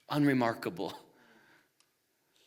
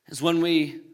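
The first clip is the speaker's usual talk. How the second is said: ordinary delivery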